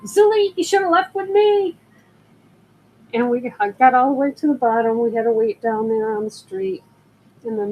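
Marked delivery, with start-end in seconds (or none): 0.0-1.8 high-pitched mock holler up the stairs; retold holler. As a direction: call up to a coworker far above, pitched way up. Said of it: "I hollered up".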